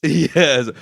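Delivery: normal talking voice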